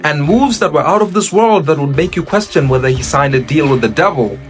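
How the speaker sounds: normal talking voice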